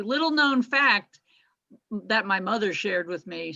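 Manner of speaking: ordinary delivery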